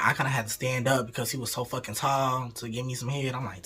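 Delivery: ordinary delivery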